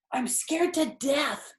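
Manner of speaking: usual speaking voice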